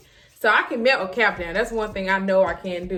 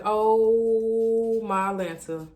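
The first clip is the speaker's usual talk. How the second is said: a long, worried oh my Lanta; nervous drawn-out exclaim; much slower than usual. Retold or imitated. Nervous stylist staring at the crooked lace, slow dismay. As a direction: stretch it out slowly, dismayed. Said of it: "I'm nervous 'cause I'm like".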